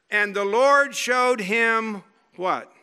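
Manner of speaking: ordinary delivery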